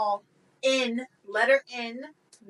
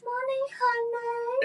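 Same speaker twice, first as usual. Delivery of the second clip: sing-song; slower than usual. Acted. Playful sing-song money honey.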